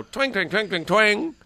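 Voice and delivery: high sung-out banjo noises, each twanged; imitated banjo twangs. Host imitates a man pretending to play banjo, high twanging. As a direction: mimic a banjo with your voice. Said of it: "make his own words".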